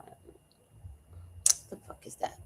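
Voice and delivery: hushed, slow whisper of the cat's voice; the cat's silent judgment, whispered slowly; whispered cat thought. Retold or imitated. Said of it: "she's going".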